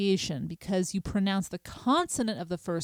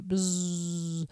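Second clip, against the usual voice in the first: buzzing bee. Shown as a demonstration. Teacher imitating the buzzing bee sound. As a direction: buzz like a bee. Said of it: "One S is a buzzing bee".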